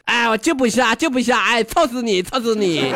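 put-on high-pitched childish taunt; taunting refusal. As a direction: tease him from out of reach. Crow taunts farmer, acted quarrel.

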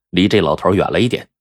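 ordinary delivery

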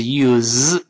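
"use" ending in a buzzing voiced z, stretched slowly. Shown as a demonstration. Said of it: "动词是use".

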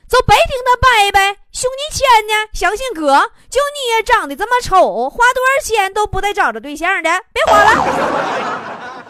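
loud, high rapid-fire heckle; urgent warning. Acted. Bystander warning a young man about a scam, loud high holler. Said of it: "我就赶紧提醒他".

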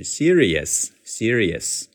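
slow serious built from "see", "re", "yes". Shown as a demonstration. Teacher rebuilds serious from its parts. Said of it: "拆分为S E E，see".

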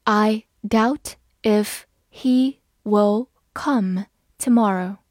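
very slow, word by word; slow read. Shown as a demonstration. Teacher modelling the sentence for students, slow and clear. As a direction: take it slowly so learners can follow. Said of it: "好，我们慢慢来读".

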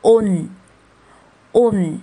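"on" said as a closed "un", falling; vowel plus nasal ending. Shown as a demonstration. Teacher combining the upward vowel with n, "on" said as falling "un". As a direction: say "on" like "oon", twice with a pause. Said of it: "朝上的哦跟下面的呢组合".

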